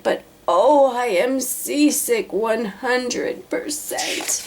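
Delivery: slow, queasy moaning elephant voice; seasick elephant. A narrator's reading. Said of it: "Horton seasick".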